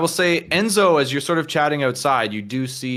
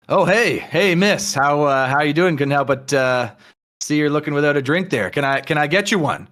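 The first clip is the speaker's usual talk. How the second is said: louder, higher-pitched chat-up; forward suitor; 5 semitones higher, 5 dB louder than usual. Acted. Armored man approaches Amy to offer a drink, forward suitor. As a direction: open eagerly with an offer of a drink.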